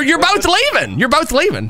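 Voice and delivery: high-pitched, exasperated insistence; insistent order. Pretend. Officer ordering two quarrelling men away.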